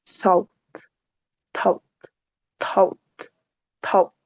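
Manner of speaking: "thought" with tongue between teeth, repeated and fading; th sound drill. Shown as a demonstration. Teacher drills "thought" for Spanish speakers, tongue between teeth. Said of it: "la lengua se va a poner entre nuestros dientes".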